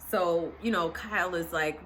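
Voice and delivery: normal talking voice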